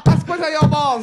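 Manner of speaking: loud, high imitation Chinese accent; mock Chinese accent. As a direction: shout in a hammed-up Chinese voice. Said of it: "Do it in the Chinese voice".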